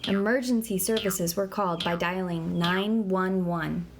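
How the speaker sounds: ordinary talk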